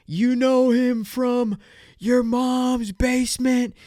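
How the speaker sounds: loud, high, drawn-out stage announcer shout; Donnell Rawlings. Retold or imitated. Comedian bringing out surprise guest, hyped-up announcing. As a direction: hype up the crowd, stretching every word.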